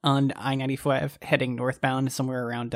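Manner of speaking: normal talking voice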